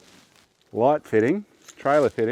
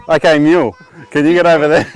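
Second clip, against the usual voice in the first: calling out; 5 semitones higher, 12 dB louder than usual. Real, not acted. Calling to helper across the yard.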